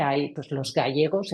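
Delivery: ordinary delivery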